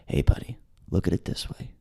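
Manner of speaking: low, hushed, confidential murmur; whispering cop. Retold or imitated. Arresting cop in crowded elevator whispers in his ear. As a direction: lean in and whisper it like a secret. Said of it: "whispers in my ear".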